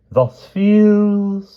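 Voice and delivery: plain reading voice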